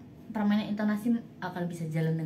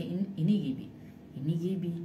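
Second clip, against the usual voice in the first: heavy, eerie, lower variation; 3 semitones lower, 5 dB quieter, faster than usual. Shown as a demonstration. Demo for listeners of a lower, heavy eerie variation. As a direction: darker and heavier take. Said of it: "bikin variasi".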